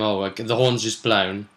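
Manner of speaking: ordinary talk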